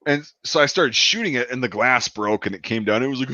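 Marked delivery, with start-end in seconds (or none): none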